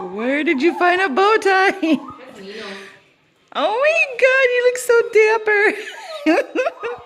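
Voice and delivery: very high, loud, slow squeal of delight. Real, not acted. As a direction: gush over the puppy in a bow tie. Delighted at puppy in bow tie.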